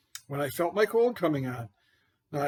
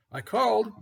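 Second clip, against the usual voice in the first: usual speaking voice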